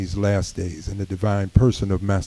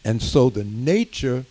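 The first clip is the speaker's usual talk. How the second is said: rising preaching; 3 semitones higher than usual. Acted. Minister exhorting the community room, preaching with rising force. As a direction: preach it to the congregation, building.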